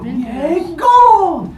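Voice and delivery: high, loud mock cheer; cheering crowd. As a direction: cheer like a crowd thrilled by treasure.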